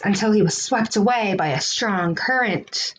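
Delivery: ordinary delivery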